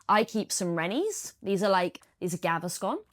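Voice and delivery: normal talking voice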